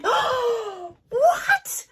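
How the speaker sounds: gasped, very high squeal; retold shock. Mom hearing her son's last call, shrieking shock. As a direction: squeak it out in hammed-up disbelief.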